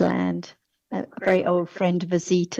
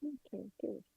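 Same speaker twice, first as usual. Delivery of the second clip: barely audible; 13 dB quieter than usual. Real, not acted. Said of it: "your voice is very l-, um, faint".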